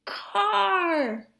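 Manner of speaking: a high, excited little holler of Car!; piggies holler. A narrator's reading. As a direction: call out Car! like a startled piglet. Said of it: "Piggies holler".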